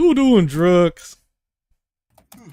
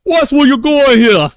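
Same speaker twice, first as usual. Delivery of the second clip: higher, louder Cockney shout; mock Cockney oi, higher and louder; 9 semitones higher, 5 dB louder than usual. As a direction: snap at a passerby in Cockney.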